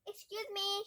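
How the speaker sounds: loud, high call; shout. Acted. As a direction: shout to get past the band. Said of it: "shouted Ben".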